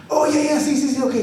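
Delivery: put-on high, eager old man's yes; grandpa agreeing. Grandpa eagerly agreeing to Nacho, high voice. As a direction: agree quickly like a keen grandpa.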